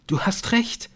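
high, breathless agreement. A narrator's reading. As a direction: gasp it out in excited realisation. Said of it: "sagte sie atemlos". (atemlos, hoch)

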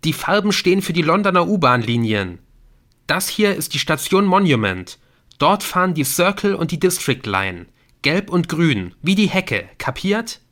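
high, loud excited explaining; eager explanation. A narrator's reading. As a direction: spell out the solution triumphantly. Nick eagerly explains the colour code. (aufgeregt erklärend, mit erhobener Stimme)

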